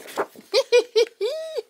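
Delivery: high, squeaky burst of giggling; high-pitched giggle. Real, not acted. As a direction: let out a high, tittering laugh. Giggles at own craft project.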